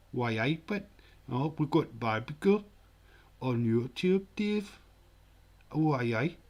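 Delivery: lilting, hammed-up Geordie impression; bad Geordie accent. Shown as a demonstration. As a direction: put on a terrible Geordie accent. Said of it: "terrible Buddy Geordie accent".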